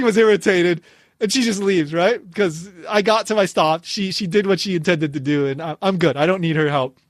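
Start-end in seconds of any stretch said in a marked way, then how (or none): none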